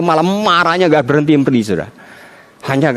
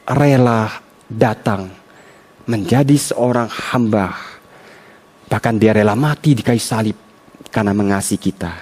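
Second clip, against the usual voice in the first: solemn hush; 5 semitones lower, 3 dB quieter than usual. Acted. Softened solemn sermon lines.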